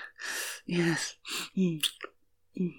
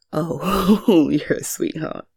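ordinary delivery

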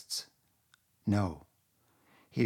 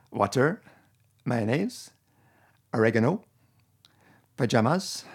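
put-on amateur Francophone Canadian accent, higher and louder; a hammed-up Québécois accent, higher and louder; 3 semitones higher, 6 dB louder than usual. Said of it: "my attempt to do, uh, Francophone Canadian accent".

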